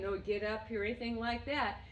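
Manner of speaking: ordinary delivery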